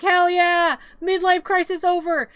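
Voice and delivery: high, loud celebration; mock triumphant cheer. Playing Zero cheering Jack's return, loud and triumphant. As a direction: cheer loudly for your friend.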